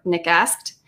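ordinary delivery, nothing marked

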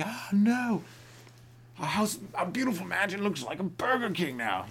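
high-pitched hammed-up wail; mock despair. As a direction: lament like a ruined mansion owner. Acted mansion owners in despair.

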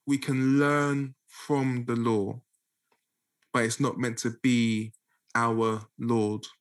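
slow, measured restatement with pauses between phrases; slowly. Shown as a demonstration. Teacher restating the key point for listeners, slowly. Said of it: "Let me say that again slowly".

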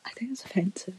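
hushed whisper, half joking; mock-guilty hushed aside; conspiratorial whisper. Real, not acted. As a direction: whisper it like a secret. Whispering about banned word.

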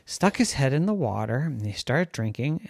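usual speaking voice

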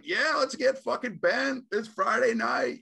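high, loud mock party whoop; hammed-up shout of Friday-night hype; rowdy party crowd. Imitating everybody cheering on drinking, rowdy mock enthusiasm. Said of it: "everybody's like".

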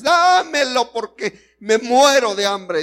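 high, loud starving plea; desperate Esaú. Acted. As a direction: beg like a man dying of hunger. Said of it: "Este hombre se desesperó y dice". (Esaú desesperado, agudo y fuerte)

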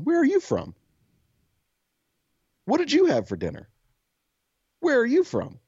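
put-on high, eager child prattling questions; kid voice. Retelling the kid pestering his friend, high childlike questions. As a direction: rattle them off like a chatty small kid.

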